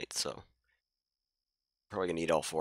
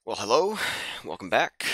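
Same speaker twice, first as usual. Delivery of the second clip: excited greeting; 4 semitones higher, 8 dB louder than usual. Real, not acted. Streamer greets viewers loudly.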